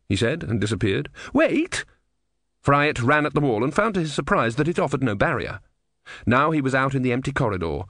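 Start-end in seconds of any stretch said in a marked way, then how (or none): 1.3-1.8 high desperate shout of wait; desperate call. A narrator's reading. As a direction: cry out after him. Calling after vanishing Death.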